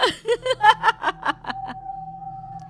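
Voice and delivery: very high evil cackle; evil witch cackle. Acted. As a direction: shriek a witch laugh.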